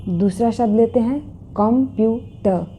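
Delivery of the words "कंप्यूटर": कंप्यूटर split as kom, pyu, tuh; three spaced beats in कंप्यूटर; syllable by syllable. Shown as a demonstration. Teacher introducing the next word, broken into its syllables. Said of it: "इसमें तीन syllables हैं".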